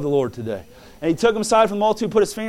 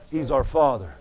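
ordinary talk, nothing marked

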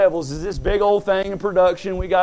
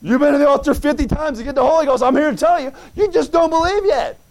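higher, louder preaching with rising fervor; fired-up preaching; 6 semitones higher, 4 dB louder than usual. Acted. Pastor exhorts the church about faith, fired-up preaching. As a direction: drive it home to the congregation.

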